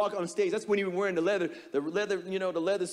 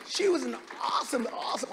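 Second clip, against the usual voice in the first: usual speaking voice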